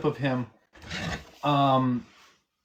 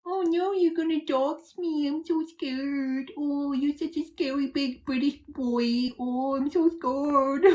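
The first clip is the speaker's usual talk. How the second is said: high, slow, sarcastic fake fright; mock fear; 11 semitones higher, 3 dB quieter, slower than usual. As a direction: squeal it like a mocking scaredy-cat. Mocking a British troll's dox threat.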